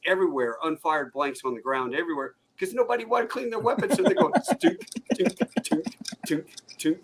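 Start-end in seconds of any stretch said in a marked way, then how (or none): 4.6-7.0 imitated tinks. Imitating blanks hitting ground.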